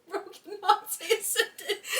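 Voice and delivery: laughing while teasing; mocking giggle. Real, not acted.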